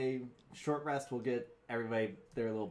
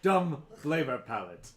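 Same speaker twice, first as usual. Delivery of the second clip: gruff brute voice; 3 semitones higher, 9 dB louder than usual. Acted. Blunt head continues.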